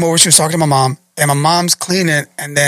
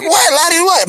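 high, urgent demand; mock panic; 12 semitones higher than usual. Neighbor pressing Larry for an answer, frantic high voice.

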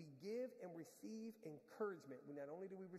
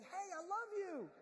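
loving call; 13 semitones higher, 6 dB louder, slower than usual. Pretend. Honk meant as encouragement.

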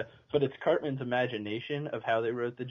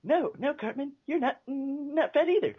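Jimmy's mom voice. Retold or imitated. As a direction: stutter like a sweet mother. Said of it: "his mom's voice saying".

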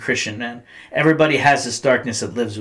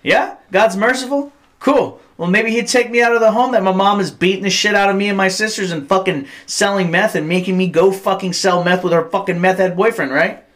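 high, sarcastic, raised retort to the cop; retold bitter outburst; 8 semitones higher than usual. Teen snapping back at the preaching cop, bitter high sarcasm. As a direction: throw it back in his face. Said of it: "I'm like".

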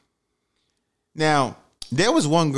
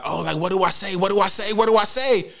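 nervous panic; 7 semitones higher than usual. Retold or imitated. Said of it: "so I'm nervous now".

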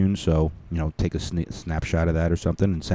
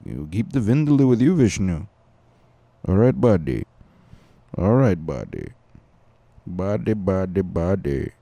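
hammed-up Indian accent, bouncing the words; lightly put-on Indian lilt; mock Indian accent; 3 dB louder than usual. Comic joking at Vishnu about vindaloo, mock Indian accent. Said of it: "That's my little Indian accent".

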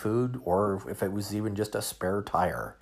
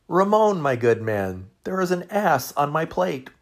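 mock calm; 7 semitones higher, 7 dB louder than usual. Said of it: "calmly said".

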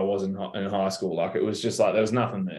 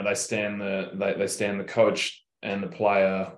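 ordinary talk, nothing marked

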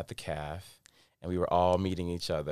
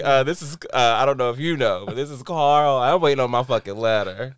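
loud, higher indignant phone demand; mock irate caller; 5 semitones higher, 9 dB louder than usual. Waiting on an acceptance letter and calling in, mock irate. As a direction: demand it like a fed-up caller, loudly.

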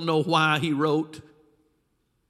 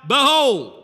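a huge, loud "Behold!" with the voice set apart, falling at the end; "Behold!" thundered out like an archangel stepping out of heaven; booming angel proclamation; 8 semitones higher, 10 dB louder than usual. Acted.

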